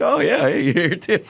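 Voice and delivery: high-pitched, playful hello; mock surprised greeting. Friend bumps into friend at the mall, high mock surprise. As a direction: lift the voice high, as if happening upon a friend. Acting out a chance mall meeting.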